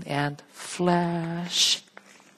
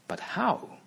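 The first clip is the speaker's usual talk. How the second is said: puzzled cry; 11 semitones higher than usual. Acted. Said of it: "cried Cinderella".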